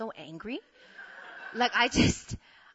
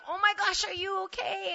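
high-pitched concerned imitation; higher voice, fretting over someone; worried question; 11 semitones higher than usual. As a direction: ask in mock alarm. Acts out his worried question.

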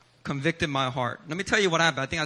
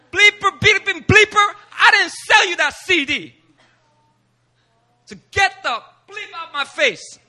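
much higher and louder furious mock rant, bleeps and all; cursing him out; 11 semitones higher, 8 dB louder than usual. The seller cursing the preacher out, a hammed-up furious rant. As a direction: blow up at him like an angry street seller. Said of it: "cursed me out".

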